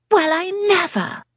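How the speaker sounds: a lightly voiced affronted lady, high; a touch of a huffy matron; snapped. A narrator's reading. Offended lady snapping as Yasmin clears the table. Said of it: "one of the ladies snapped".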